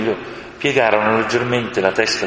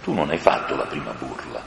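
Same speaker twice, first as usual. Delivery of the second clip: lower, soft lion voice; gentle lion; 7 semitones lower, 7 dB quieter than usual. A narrator's reading. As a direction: answer the little crow kindly. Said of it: "rispose il leone". (il leone risponde dolce, più grave e piano)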